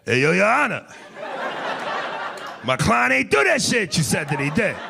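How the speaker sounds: gruff-rapper closing argument, swooping pitch; rhythmic rapper playing a lawyer. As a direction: plead the case like a rapper in court. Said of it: "He be giving his closing argument".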